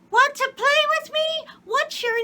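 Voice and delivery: put-on very high, loud child voice; little girl's voice. A narrator's reading. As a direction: squeal like a small girl. Child asks another girl.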